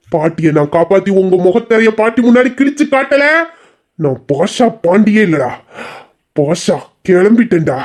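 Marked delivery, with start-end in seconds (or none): 4.0-7.8 slow, low hero dialogue; film hero punch line. Acted. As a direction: deliver it like a mass movie hero. Hero movie-style punch line. (மெதுவான தாழ்ந்த hero punch வசனம்)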